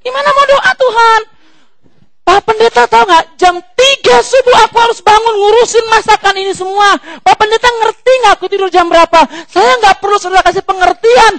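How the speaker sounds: high, loud, indignant put-on congregant voice; mock whiny complaint. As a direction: complain shrilly to the pastor about waking early. Complaining congregant arguing with pastor.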